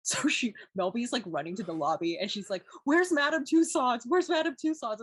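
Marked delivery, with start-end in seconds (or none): 2.8-5.0 high, loud, urgent repeated question; frantic asking. Retold or imitated. Mother racing through the lobby for directions, loud and frantic. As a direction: blurt it out in a rush of panic.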